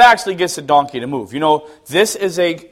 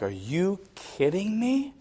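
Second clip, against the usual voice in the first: slow, hushed, higher-pitched incredulity; stunned disbelief; 4 semitones higher, 11 dB quieter than usual. Retold or imitated. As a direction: draw it out softly, unable to believe it. Father disbelieving at child's refusal.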